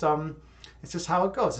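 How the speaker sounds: ordinary talk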